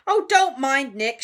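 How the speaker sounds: loud, breezy call; shouting. A narrator's reading. Sir Patrick's head dismissing Nick from the floor, shouting. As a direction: call out jovially over the crowd. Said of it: "shouted Sir Patrick's head".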